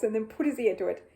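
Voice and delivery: ordinary delivery, nothing marked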